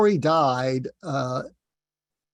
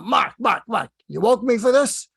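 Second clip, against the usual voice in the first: imitated Brooklyn accent, higher and louder, exasperated; Larry King Brooklyn accent; 7 semitones higher, 4 dB louder than usual. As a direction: complain in a mock Brooklyn accent about being woken. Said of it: "in his Brooklyn accent".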